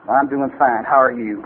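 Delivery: low-pitched copy of a cowboy-bar regular; mimicking the barfly. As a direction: answer low, in the barfly's own voice. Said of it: "whoever I'm around, I start acting like".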